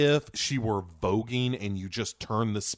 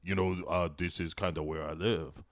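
lower, softer sheepish monster voice; Killer Croc aside; 6 semitones lower, 6 dB quieter than usual. Retold or imitated. Podcaster voicing Killer Croc to the divers, low and sheepish. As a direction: mumble it low like a shy sewer creature. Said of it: "And he's like".

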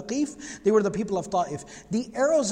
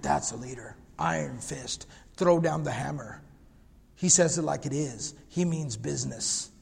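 dropping much lower for a gruff mock admirer; mock tough-guy admirer; 6 semitones lower, 4 dB quieter than usual. Preacher mimicking people who admire harsh leaders in a lower gruff voice. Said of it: "We say, like".

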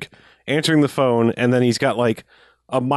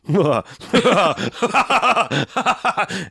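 pleased cackle; 8 semitones higher than usual. Retold or imitated. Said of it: "he's just cackling".

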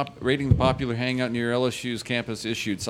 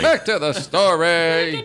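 mock announcer; 4 semitones higher, 5 dB louder, slower than usual.

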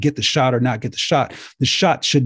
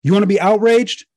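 louder, higher, pushing the challenge harder; outraged call-out; 7 semitones higher, 4 dB louder than usual. Real, not acted. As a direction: hammer the question home to the crowd. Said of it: "I'm outraged".

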